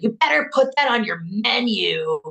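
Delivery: put-on loud, high, rough child's demand; angry and rough. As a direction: bark it like an angry kid. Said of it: "like, really angry and rough".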